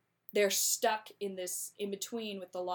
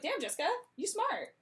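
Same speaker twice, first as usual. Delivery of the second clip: impressed; 10 semitones higher than usual. Retold or imitated. Mock impressed reaction.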